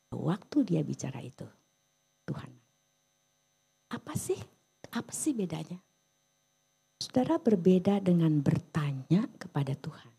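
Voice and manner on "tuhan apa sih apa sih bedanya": ordinary delivery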